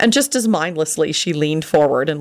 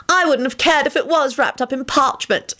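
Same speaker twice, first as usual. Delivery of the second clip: high-pitched, stubborn declaration; 9 semitones higher than usual. A narrator's reading. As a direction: insist on it, unbudging. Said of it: "declared stubbornly".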